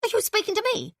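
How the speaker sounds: high, indignant wife; affronted landlady. A narrator's reading. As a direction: bristle at your husband's cheek.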